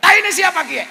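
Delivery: high, loud shout of protest; indignant yell. Retold or imitated. Cilacap man finds mess on his foot, indignant yell. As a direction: yell out like an outraged Cilacap local. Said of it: "Orang Cilacap teriak".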